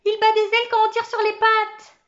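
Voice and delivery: voix très aiguë, criée de joie; exclamation émerveillée. Acted. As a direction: crie ta découverte à toute la classe. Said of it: "s'écria Karine". (cris ravis et suraigus)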